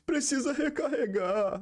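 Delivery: high, slow, whiny dubbed soldier line; imitating a badly dubbed game voice; mock crying dub line. Gamer mocking the Brazilian dub, whiny high voice. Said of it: "e o boneco fica assim".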